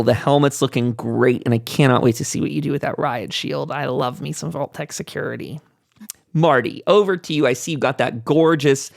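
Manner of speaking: ordinary delivery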